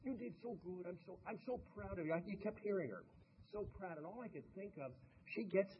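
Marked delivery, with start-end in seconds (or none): 0.0-2.1 put-on a lightly done, higher Romanian accent; a hint of a Romanian coach praising. Imitating the coach praising her gymnasts, in a light Romanian accent. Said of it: "with that rolling Romanian accent". 3.5-4.0 put-on a lightly done, higher Romanian accent; a hint of a Romanian coach praising. Imitating the coach praising her gymnasts, in a light Romanian accent. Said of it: "with that rolling Romanian accent".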